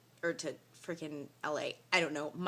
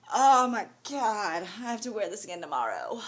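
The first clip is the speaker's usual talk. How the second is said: weary "oh my God" wail; exasperated groan; 2 semitones higher, 7 dB louder than usual. Real, not acted. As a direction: groan it out in dismay. Groaning about re-wearing same clothes.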